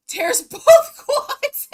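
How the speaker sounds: laughs breaking the words up, high and loud; real helpless laughter, slow; laughing hard at the video. Real, not acted.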